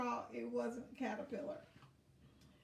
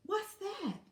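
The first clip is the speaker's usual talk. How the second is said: curious surprise; 9 semitones higher, 3 dB louder than usual. Acted. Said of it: "he thought".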